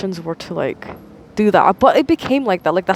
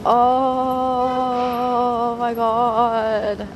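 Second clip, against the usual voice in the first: very long, higher sustained exclamation; drawn-out realization; 4 semitones higher, much slower than usual. Real, not acted. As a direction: stretch each vowel out as it sinks in.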